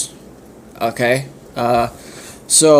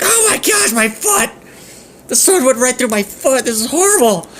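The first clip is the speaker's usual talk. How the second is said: high, frantic cry of pain; high pained wail; panicked scream; 11 semitones higher than usual. Acted. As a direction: shriek about the foot in panic. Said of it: "And he starts to scream".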